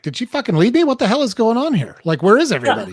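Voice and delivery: high, panicked disbelief; mock alarm at coming home to an empty house; retold panic. Alone at home, he panics about his missing wife, pitch high. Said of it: "And I'm like".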